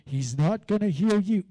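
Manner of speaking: loud, low scoffing voice; mock doubter shout. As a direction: bark out the doubt. Preacher voicing doubters.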